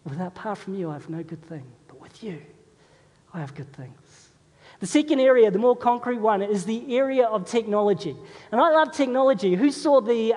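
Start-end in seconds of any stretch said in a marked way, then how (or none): 0.1-4.2 soft, hushed words to God; hushed, sincere prayer voice; quiet prayer. Acted. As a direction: pray it softly, almost to yourself.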